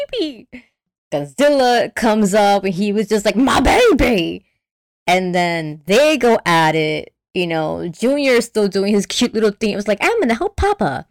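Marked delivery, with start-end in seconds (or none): 0.1-0.5 high, softer mock wail; mock horrified plea. Retold horrified reaction to Junior hurt. 3.4-4.5 higher, drawn-out parental wail; hammed-up monster parent crying out; mock anguished cry. Godzilla cries out for his child. 10.0-11.1 put-on high, cutesy child voice; little kid. Voicing little Junior.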